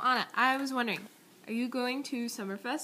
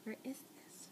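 whispered question; soft whispered aside; 15 dB quieter than usual. Real, not acted. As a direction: whisper it to yourself. Wondering about vacation photo.

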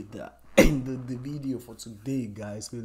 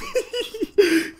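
high breathless laugh; 6 dB louder than usual. Real, not acted. Laughs after jumping around.

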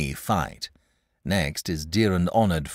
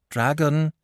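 higher, pleading "dragon"; imploring; 7 semitones higher than usual. A narrator's reading. Boy imploring the stubborn dragon. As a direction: beg him with the name. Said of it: "said the boy imploringly".

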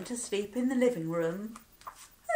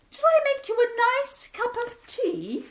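high, loud, motherly voice; Missus Bear; 14 semitones higher, 7 dB louder than usual. Acted. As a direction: comfort him like a fussing mother bear. Missus Bear comforting husband.

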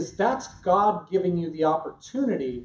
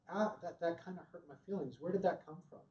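much softer, tender and vulnerable; soft, hurt question; 15 dB quieter, much faster than usual. Shown as a demonstration. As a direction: ask it gently, open to hurt. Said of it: "The tonality".

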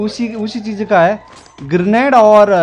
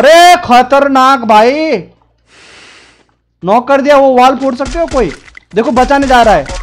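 excited callout; 6 semitones higher, 6 dB louder than usual. Real, not acted. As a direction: hype yelling after a knock. Excited mid-fight callout. (जोश में चिल्लाना)

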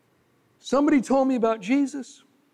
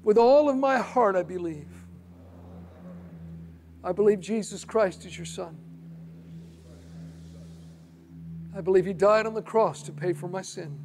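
quiet, earnest prayer for the listener to repeat; sinner's prayer; 3 semitones lower, 5 dB quieter than usual. Shown as a demonstration. Preacher leading listeners in prayer, quiet and earnest. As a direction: pray it softly and sincerely, line by line. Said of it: "You say".

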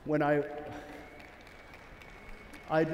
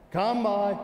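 raised command call; 6 semitones higher, 4 dB louder than usual. Real, not acted. Commanding the dog.

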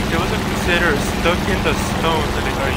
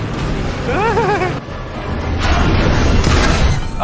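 high laugh. Real, not acted. Laughs at himself.

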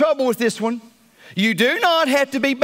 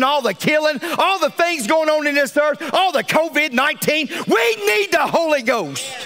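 raised, higher preaching cry; preaching at full force; 7 semitones higher than usual. Acted. Pastor crying out to his congregation, preaching at full force. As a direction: preach it to the rafters.

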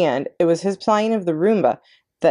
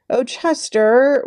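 slow, higher, louder wail; 9 semitones higher, 4 dB louder, much slower than usual. A narrator's reading. Tucker Mouse wails to Chester, begging him not to despair. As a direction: wail out his friend's name. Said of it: "wailed Tucker Mouse".

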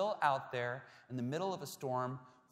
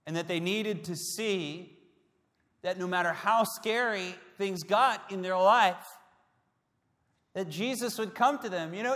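louder, higher, impassioned sermon; preaching at full force; 7 semitones higher, 8 dB louder than usual. Acted. Preacher to congregation, rising with passion. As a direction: lift the room with conviction.

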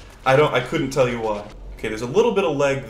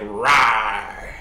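slow, drawn-out mock monster roar; half-hearted boss introduction; Ceaseless Discharge voice; much slower than usual. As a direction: growl it lazily like a harmless monster. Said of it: "sitting there going like".

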